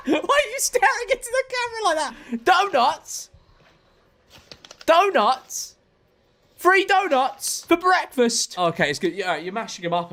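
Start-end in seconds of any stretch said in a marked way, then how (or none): 0.1-8.6 higher, disbelieving; incredulous. Real, not acted. Viewer shocked at donuts for a toddler, incredulous.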